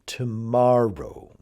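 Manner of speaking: "Tomorrow" slow, middle syllable held; emphasize the MOR in "Tomorrow"; stress on MOR. Shown as a demonstration. Teacher models the syllable to emphasize, "Tomorrow" stressed on MOR. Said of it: "syllable you should emphasize".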